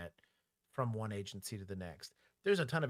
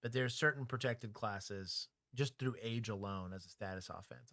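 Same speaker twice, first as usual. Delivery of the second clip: ordinary talk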